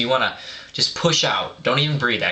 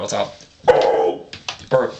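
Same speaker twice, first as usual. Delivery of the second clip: guttural growl demo; much slower than usual. Shown as a demonstration. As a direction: growl it from the throat like a deathcore singer. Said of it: "Devourment use guttural".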